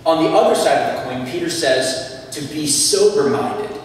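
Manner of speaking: ordinary talk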